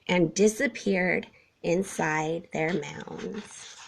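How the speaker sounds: plain reading voice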